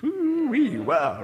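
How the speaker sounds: high whoop of pretend wonder; mock amazement. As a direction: let out an impressed whoop. Mock reaction to East Coast weather.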